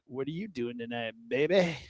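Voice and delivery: low, soft mock-seductive pickup line; lightly voiced smooth flirt; William Shatner. Imitating the movie sheriff hitting on a woman, low and soft. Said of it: "William Shatner being like".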